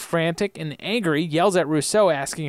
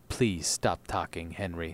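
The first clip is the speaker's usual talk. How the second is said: low, quiet order; 7 semitones lower, 7 dB quieter than usual. A narrator's reading. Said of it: "And then Echo says".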